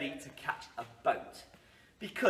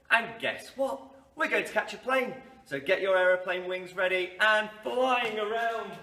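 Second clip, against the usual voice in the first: ordinary talk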